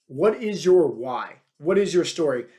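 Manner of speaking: usual speaking voice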